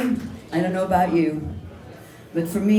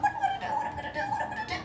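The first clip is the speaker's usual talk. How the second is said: very high, squeaky frantic turkey; a frightened bird squeaking its worry over and over; panicked turkey voice; 9 dB quieter, much faster than usual. Acted.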